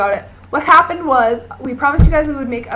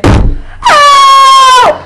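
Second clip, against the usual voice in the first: very high, very loud desperate yell; a shrill cartoon cry for rescue; yelling at the top of lungs; 12 dB louder, much slower than usual. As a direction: scream for help as loud as you can. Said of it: "yell at the top of your lungs".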